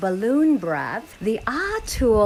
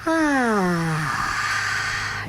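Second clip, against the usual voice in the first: soothing "ah". Shown as a demonstration. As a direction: breathe out a gentle ah. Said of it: "So when you go".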